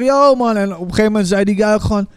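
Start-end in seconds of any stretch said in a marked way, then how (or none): 0.0-0.6 tag-along greeting. Retold or imitated. Acts out calling back up.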